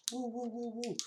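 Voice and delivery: high ghostly wail, held long; spooky ghost woo. Pretend. Vlogger spooking viewers between segments, high ghostly wailing. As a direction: moan it like a cartoon ghost.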